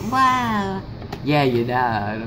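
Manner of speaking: normal talking voice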